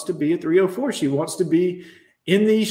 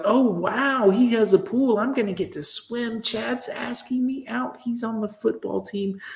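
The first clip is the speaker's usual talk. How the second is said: put-on excited girl; 5 semitones higher than usual. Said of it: "And she was like".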